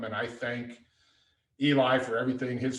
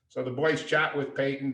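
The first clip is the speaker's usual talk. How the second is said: normal talking voice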